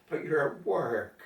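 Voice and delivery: normal talking voice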